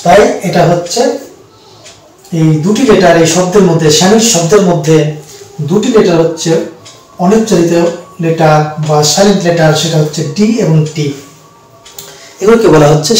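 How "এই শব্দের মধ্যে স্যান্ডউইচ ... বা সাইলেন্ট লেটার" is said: ordinary delivery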